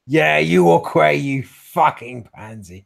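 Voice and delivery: loud, jeering yell; drawn-out insult shouted from afar; screaming out the window. Acted. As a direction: yell a taunt down the street. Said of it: "Hector's just screaming out the window".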